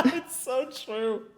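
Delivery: high giggly agreement; laughing. Real, not acted. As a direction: laugh through the words. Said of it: "what he's saying is fucking ridiculous".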